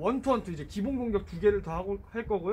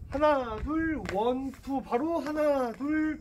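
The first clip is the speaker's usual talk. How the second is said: counting cadence; 6 semitones higher, much slower than usual. Shown as a demonstration. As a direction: call the count like a coach. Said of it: "그러면은".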